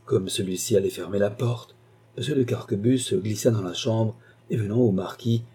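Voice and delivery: ordinary delivery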